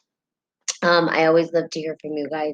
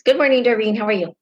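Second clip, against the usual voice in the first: a higher, louder greeting in a New Mexican accent; a friendly "good morning" in her own New Mexican accent; 6 semitones higher, 4 dB louder, faster than usual. Shown as a demonstration. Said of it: "I have a New Mexican accent".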